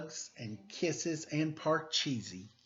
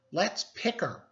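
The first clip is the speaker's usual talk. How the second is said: teasing; 8 semitones higher, 5 dB louder than usual. A narrator's reading. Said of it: "said Rita".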